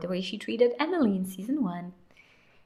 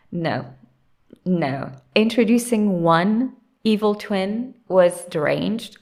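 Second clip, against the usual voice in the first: usual speaking voice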